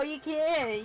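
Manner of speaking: normal talking voice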